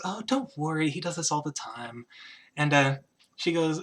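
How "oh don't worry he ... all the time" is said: reassuring queen. Acted. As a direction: reassure the visitor softly, as the queen. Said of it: "she turns towards you and she goes".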